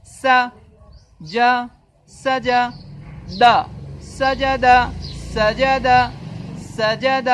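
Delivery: teacher modelling سجد for pupils; spelling drill. Shown as a demonstration. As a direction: build سجد letter by letter. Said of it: "ایک word کو یاد کرنے کے بعد".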